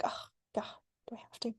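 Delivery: breathy, barely voiced groan of reluctance; reluctant whisper. Her human self whispering a reluctant groan. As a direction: whisper it like you dread the task. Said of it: "my human self could be like".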